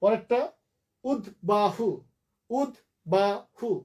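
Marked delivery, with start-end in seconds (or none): 1.0-3.7 উদ্বাহু slowly as ud, ba, hu; syllable by syllable. Shown as a demonstration. Teacher dividing a word for students, syllable by syllable. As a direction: give উদ্বাহু three separate beats. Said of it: "তিনটা".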